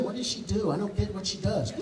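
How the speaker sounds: half-laughing, quietly baffled; muttering staff. Retold or imitated. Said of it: "my staff is like".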